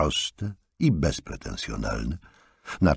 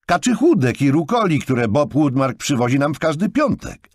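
insistent; 11 semitones higher, 6 dB louder than usual. A narrator's reading. Jonathan pressing about delivery.

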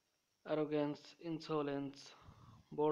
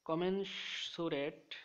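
কমেনসুরেট drawn out slowly, syllable by syllable, pitched higher; slow headword; 3 semitones higher, 3 dB louder, much slower than usual. Shown as a demonstration. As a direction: announce কমেনসুরেট slowly as the new word. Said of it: "শব্দের অর্থ হলো".

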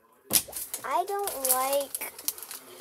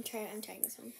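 whispering; 4 semitones lower, 12 dB quieter than usual. Real, not acted. Whispers while tasting.

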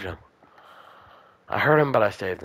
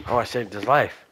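ordinary delivery

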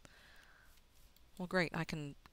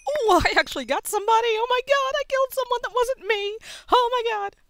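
high, very loud excited screaming; ecstatic disbelief; 14 dB louder than usual. Real, not acted. Player celebrates an unexpected first kill, ecstatic screaming. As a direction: scream in thrilled shock at a first win.

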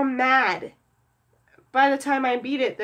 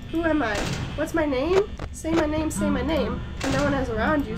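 ordinary delivery

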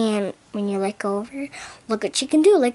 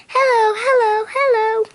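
very high, a bit louder toy bird; squeaky bird voice; 11 semitones higher, 4 dB louder than usual. Pretend. Kid voicing her toy bird in a high squeak. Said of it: "This is how she talks".

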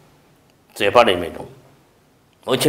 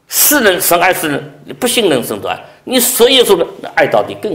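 loud, high impassioned preaching; fiery sermon delivery; 6 semitones higher, 7 dB louder than usual. Acted. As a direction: drive it home to the congregation. Preaching at full force.

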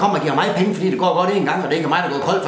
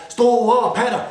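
mock celebratory shout; 6 semitones higher, much slower than usual.

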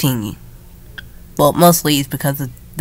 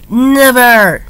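defiant cry; 7 semitones higher, 6 dB louder than usual. Pretend. Defiant shout.

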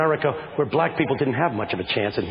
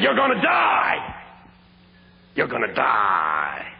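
pastor's sermon; 8 semitones higher than usual. Retold or imitated. Pastor warning students about death, fiery preaching. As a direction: warn them like a fiery old pastor. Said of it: "that's the way he talks".

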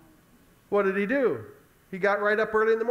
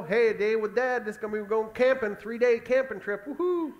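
put-on excited boy; 3 semitones higher than usual. Isaac off camping with Dad, playfully excited. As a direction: play a kid thrilled about a trip. Acts Isaac happily trolling along.

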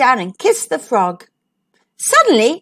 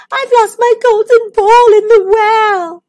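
put-on high, loud, bright girlish princess; cartoon princess; 10 semitones higher, 7 dB louder than usual. Princess voiced in story.

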